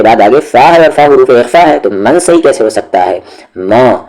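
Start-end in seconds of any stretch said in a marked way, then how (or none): none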